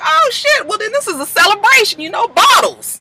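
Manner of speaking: imitating a hyped friend calling for bottles; excited cheer. Asher cheering at dinner.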